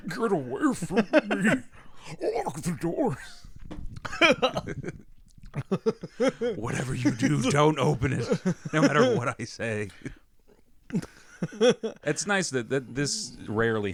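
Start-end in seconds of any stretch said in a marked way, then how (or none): 0.1-9.9 mock panic. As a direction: play a horror victim begging, half-joking. Acting scared of werewolf neighbour.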